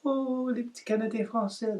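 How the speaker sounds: put-on high, light Parisian French accent. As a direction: quote them in a lilting Parisian French accent. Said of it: "people have even said to me".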